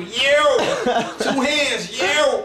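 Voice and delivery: high excited call. Retold or imitated. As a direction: hail the crowd with both hands. Waving and calling to crowd.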